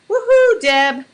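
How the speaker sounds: high, loud woohoo; excited cheer. Real, not acted. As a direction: cheer it out happily. Cheering for old rival.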